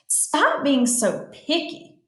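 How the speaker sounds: grouchy retort. Acted. Matthew tells his friend off, grouchily. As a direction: tell a friend off, stretching the words. Acted quarrel in a story.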